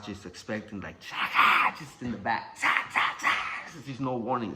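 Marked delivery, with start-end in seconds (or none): 1.0-1.7 loud, harsh whack sound; imitated whipping noise. Expecting mom's beating in the house, imitated whack. 2.6-3.3 three sharp voiceless whacks; rapid-fire sounds of blows landing; imitated whipping noises. Expecting mom's beating with no warning, imitated whacks.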